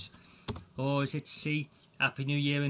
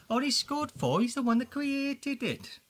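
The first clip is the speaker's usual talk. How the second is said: mock disbelief; 11 semitones higher, 4 dB louder than usual. Quizmaster scored low on own quiz.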